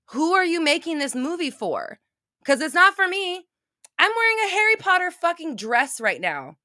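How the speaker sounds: outraged demands, pitched high; real anger. Real, not acted. Harry Potter fan berating the filmmakers, genuinely angry. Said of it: "That was real, true, like, anger".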